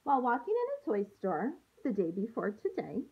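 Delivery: put-on gentle storybook read-aloud for young students; picture book read to a class; reading to children. Teacher reads picture book aloud.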